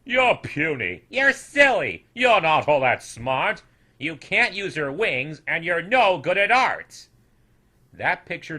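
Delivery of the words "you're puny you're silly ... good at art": loud, jeering insults; cruel taunting. A narrator's reading. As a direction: pile the insults on. Snoodles hurling insults.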